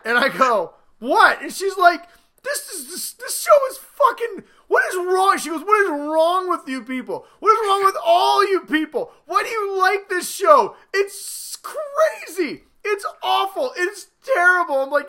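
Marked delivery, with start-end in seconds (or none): 1.0-1.4 mock innocent what. Feigned innocence. 2.4-14.8 much higher, hammed-up wailing outrage of the wife; upset wife. Wife upset, voiced shrilly.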